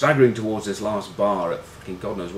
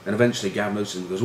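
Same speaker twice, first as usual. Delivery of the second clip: normal talking voice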